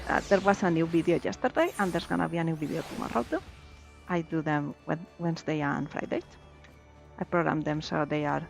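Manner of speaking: ordinary delivery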